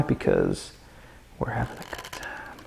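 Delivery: hushed, breathy secret whisper; sudden stage whisper. Acted. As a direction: lean in and whisper it. Drops to a whisper.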